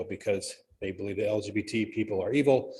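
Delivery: ordinary delivery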